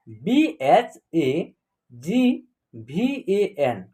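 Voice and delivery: very slow, drawn-out letter by letter spelling. Shown as a demonstration. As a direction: spell it out slowly so students can write. Spelling whole word slowly.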